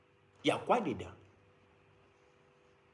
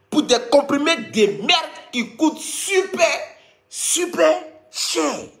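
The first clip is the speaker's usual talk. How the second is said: drawn-out mock outrage; 6 semitones higher, slower than usual.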